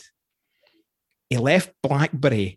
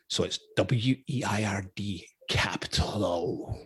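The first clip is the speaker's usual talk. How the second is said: whispered spelling; 4 semitones lower, 8 dB quieter, slower than usual. Retold or imitated. Daughter spelling her own weirdo.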